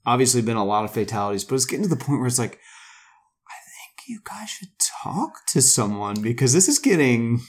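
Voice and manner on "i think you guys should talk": hushed whisper aside. Real, not acted. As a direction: lean in and whisper it.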